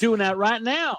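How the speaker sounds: high, folksy Southern twang; mock Andy Griffith style; Southern accent. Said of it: "talks like they've been on the Andy Griffith Show".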